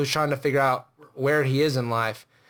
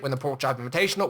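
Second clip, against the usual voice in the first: ordinary talk, nothing marked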